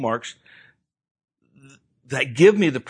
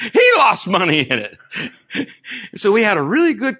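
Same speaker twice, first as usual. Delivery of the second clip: laughing; 8 semitones higher, 4 dB louder than usual. Real, not acted. Preacher laughing to the church about Jack's loss.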